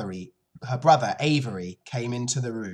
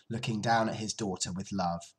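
usual speaking voice

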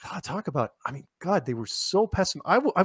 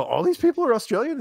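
high, startled disbelief; disbelieving question; 12 semitones higher than usual. Retold or imitated. On set, realizing everyone else is an actor, high startled disbelief. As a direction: shoot up in pitch with surprise. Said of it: "I go".

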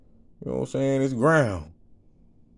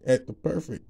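usual speaking voice

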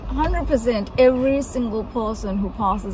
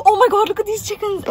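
excited; 9 semitones higher, 4 dB louder, much faster than usual. Real, not acted. Excited about the chickens.

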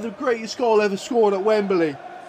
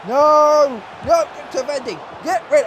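desperate shout; 6 semitones higher than usual. Real, not acted. Fan yelling at his defenders, desperate shout. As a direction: yell it at the screen.

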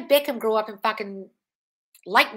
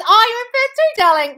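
much higher, gushing "darling"; delighted; 14 semitones higher, 11 dB louder than usual. Real, not acted. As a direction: squeal it out with delight at the news. Delighted reply to a viewer comment.

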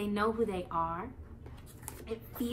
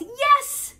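high, much louder joyful shout of yes; joyous yell; 12 dB louder than usual. Acted. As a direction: burst out with joy. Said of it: "yells, joyously".

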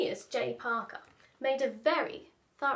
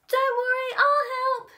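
put-on eager child; 12 semitones higher, 8 dB louder than usual. Eager boy offers.

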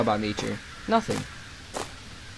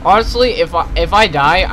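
exasperated outburst; 3 semitones higher, 8 dB louder than usual. Real, not acted. Frustrated at motion blur.

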